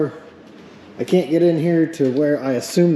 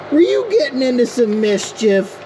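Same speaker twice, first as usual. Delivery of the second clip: high teasing voice; 10 semitones higher than usual. Pretend. Teasing the car owner playfully.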